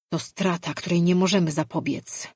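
ordinary delivery

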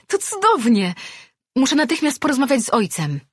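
high, loud and fast with joy; excited outburst. Acted. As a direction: rush the words out in delight. Imi podskakuje z radości. (podekscytowana, wysoko, głośno i szybko)

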